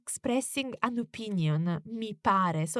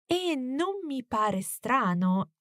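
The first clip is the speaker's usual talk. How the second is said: usual speaking voice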